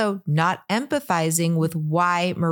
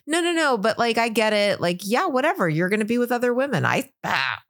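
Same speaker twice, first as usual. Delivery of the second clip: frantic backpedalling; 2 semitones higher than usual. Retold or imitated. Host imitates her trying to fix it, frantically casual. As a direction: imitate someone scrambling to seem cool. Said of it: "running like a little hamster on the wheel".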